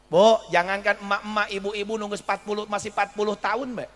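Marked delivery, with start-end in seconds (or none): none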